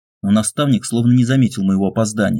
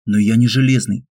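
ordinary delivery

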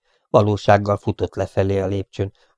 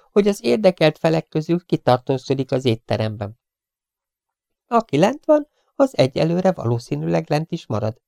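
higher, brisk detective explaining a plan; Leon's voice; 8 semitones higher than usual. A narrator's reading. Leon explains the search plan to the professor, brisk and raised. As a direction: lay out the plan like a sharp young sleuth.

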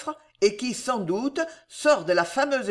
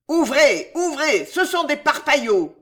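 loud, higher furious yelling; vociferating; 6 semitones higher, 5 dB louder than usual. A narrator's reading. Riders yelling together at the gate, loud. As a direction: scream at the guards to open. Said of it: "ils vocifèrent".

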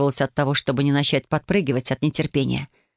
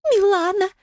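very high, bell-like call; ringing mirror voice. A narrator's reading. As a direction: chime the name like a little bell. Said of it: "прозвенела Матильда".

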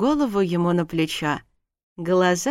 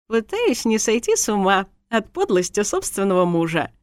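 tipsy, lightly giggly retort; a touch of a giggle; giggling; 2 semitones higher than usual. A narrator's reading. Tipsy wife answers her husband, mildly giggly. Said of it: "хихикнула я".

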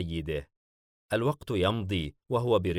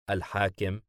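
plain reading voice

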